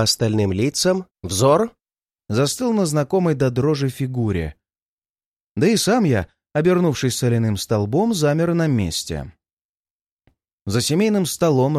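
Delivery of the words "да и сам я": ordinary delivery, nothing marked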